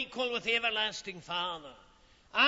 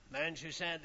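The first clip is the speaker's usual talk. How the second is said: normal talking voice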